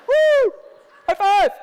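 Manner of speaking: high, loud whoop; mock celebration. Dater celebrating a quick conquest, loud mock whoop. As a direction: cheer it out at full pitch, hammed up. Acting out celebration after a date.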